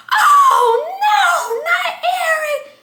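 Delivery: high, loud crying shout, dragged out slowly on the last words; wailing grief. Retold or imitated. The aunt hears the father died, screaming and sobbing. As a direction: cry out the name as if the floor gave way.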